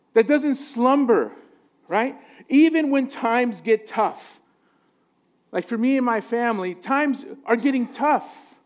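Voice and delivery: normal talking voice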